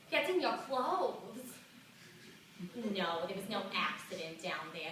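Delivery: put-on reluctant old New York lady confiding in an interviewer; old lady telling her secret; elderly woman's monologue. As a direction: play a seventy-two-year-old, embarrassed but opening up. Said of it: "This monologue is for her".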